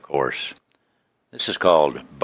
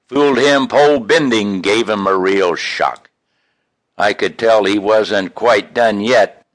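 lively storytelling verse, a little louder; poem recitation; 5 semitones higher, 4 dB louder than usual. Acted. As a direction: spin it like a tall fishing tale.

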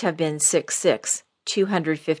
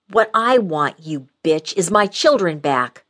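furious; 6 dB louder than usual. A narrator's reading. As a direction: hurl every word at her. Furious daughter confronting mother.

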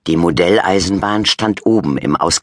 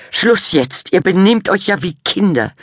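high, loud scolding mum; mum snorting; 5 dB louder than usual. A narrator's reading. Mum scolding bickering children, high and loud. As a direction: cut the kids off sharply. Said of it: "schnaubte Mama".

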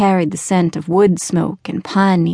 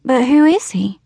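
put-on curious child; 6 semitones higher than usual. A narrator's reading. Child pressing about the ghost.

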